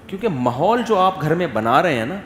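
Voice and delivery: ordinary talk, nothing marked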